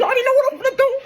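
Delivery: very high, very fast burst; excited. Real, not acted. Telling viewers he is heading back to college, very high quick squeal. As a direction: rattle it off in a squeal.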